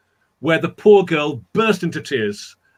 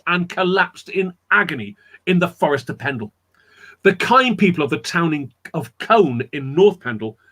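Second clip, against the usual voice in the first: usual speaking voice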